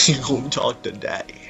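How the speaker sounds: usual speaking voice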